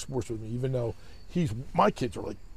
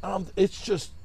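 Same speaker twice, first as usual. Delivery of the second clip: ordinary delivery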